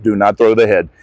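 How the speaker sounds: usual speaking voice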